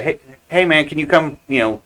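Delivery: ordinary talk, nothing marked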